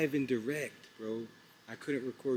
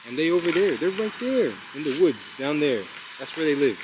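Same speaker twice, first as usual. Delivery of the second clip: pointing out; 4 semitones higher, 9 dB louder than usual. Real, not acted. Pointing out homeless camp.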